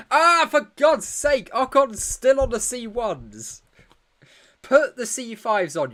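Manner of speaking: high, loud, slow exasperated cry at the screen; real exasperated yell at a timid tyre call; frustrated outburst. Real, not acted. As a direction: let the frustration burst out, loud and drawn out. Frustrated at Ocon staying on hards.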